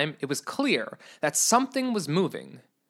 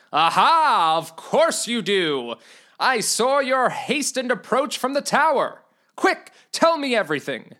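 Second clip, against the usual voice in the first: eager knight; 6 semitones higher, 3 dB louder than usual. Acted. Knight voiced in read story.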